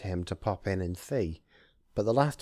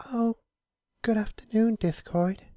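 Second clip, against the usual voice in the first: high, light, much higher and louder greeting; cheerful Fluttershy; 14 semitones higher, 5 dB louder than usual. Acted. Fluttershy greets Discord at her door, high and cheerful. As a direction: chirp it sweetly like a gentle pony. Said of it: "she greeted as cheerfully as ever".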